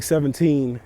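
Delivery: normal talking voice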